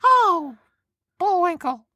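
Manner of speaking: very high squirrel voice; squeaky cartoon squirrel; Rocky voice. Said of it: "I don't know how to do all those voices".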